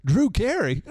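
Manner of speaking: high, loud exclamation of the name; hammed-up name shout; excited fan cry. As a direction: call it out like a thrilled fan. Mock fan outburst.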